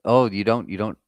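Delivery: normal talking voice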